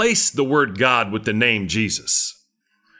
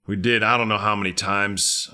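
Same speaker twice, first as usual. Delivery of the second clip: normal talking voice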